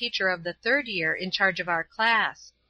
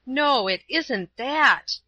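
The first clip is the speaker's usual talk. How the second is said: slow, louder, raging denial; in a rage; 5 semitones higher, 4 dB louder, much slower than usual. A narrator's reading. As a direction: spit the denial out in a slow fury. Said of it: "I answered in a rage".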